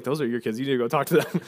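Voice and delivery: lightly voiced wife, quiet and matter-of-fact; wife's retort. Wife telling husband to handle the kids, low and quiet. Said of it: "Abigail's like".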